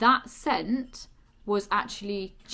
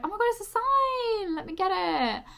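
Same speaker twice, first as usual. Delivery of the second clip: excited squeal; 13 semitones higher, 7 dB louder than usual. Retold or imitated. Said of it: "So I was like".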